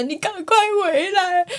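high, slow, whiny imitation of crying; mock crying plea. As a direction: beg them to come back while sobbing. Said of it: "然后你就在哭".

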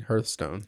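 Hearthstone said as HURTH-stone, like earth; own pronunciation of Hearthstone. Shown as a demonstration. Co-host answers which way they say it, HURTH-stone. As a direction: say Hearthstone with an "er" vowel. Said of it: "Which way do you say it".

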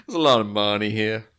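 a hint of a put-on American accent, laughing; imitating a fake American accent. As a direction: mimic the actor's slurred American voice. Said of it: "slur his words to sound more American".

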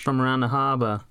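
put-on Boston accent. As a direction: say it like a Boston local. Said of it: "I'm gonna keep doing my Boston voice".

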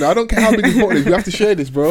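laughing through the words. Real, not acted. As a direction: chuckle while you complain. Laughing while joking.

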